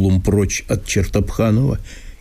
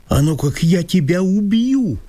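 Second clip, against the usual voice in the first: shout; 8 semitones higher than usual. Acted. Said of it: "крикнул он вдруг".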